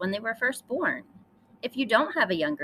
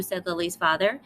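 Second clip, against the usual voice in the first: ordinary delivery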